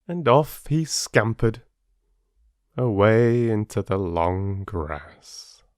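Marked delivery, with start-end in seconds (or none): none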